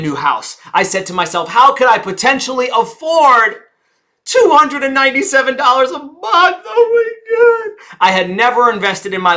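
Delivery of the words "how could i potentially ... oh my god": higher, louder, wailing oh my God; hammed-up money panic; mock panic. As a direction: fret like you're panicking. Retold panic about cost.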